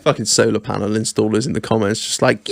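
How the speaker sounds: usual speaking voice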